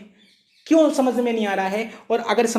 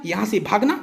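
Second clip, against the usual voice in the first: "यहाँ से भागना" cut off before "मत", rising on "भागना"; pause after भागना. Shown as a demonstration. Teacher showing how the other person misread it, stopping on a rising "भागना". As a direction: lift "भागना" and stop as at a comma. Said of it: "उसने भागना के पीछे लगा दिया".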